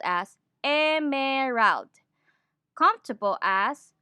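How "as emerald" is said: "emerald" slow, every vowel sounded in full; Tagalog English pronunciation. Shown as a demonstration. Teacher shows Filipinos the school-taught way, choppy syllables. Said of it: "We were taught to pronounce emerald".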